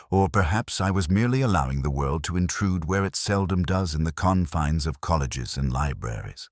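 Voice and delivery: ordinary delivery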